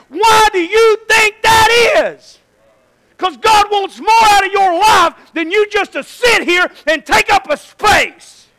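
high, slow, shouted exhortation; preaching at full force. Real, not acted. Preacher exhorting the congregation sitting here, full-force preaching. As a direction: thunder at the congregation, stretching key words.

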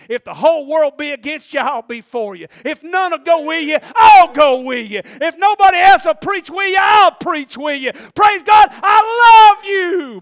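building to a high, drawn-out shout; full-force preaching. Acted. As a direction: climb into a roaring sermon climax.

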